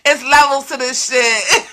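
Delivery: amused emphatic declaration; laughing. Real, not acted. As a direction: laugh it out as you insist.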